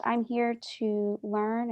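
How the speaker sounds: usual speaking voice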